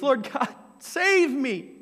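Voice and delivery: high, loud plea for rescue; a panicked prayer shouted upward; desperate cry. Pretend. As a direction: cry out as if going under. Cry while sinking.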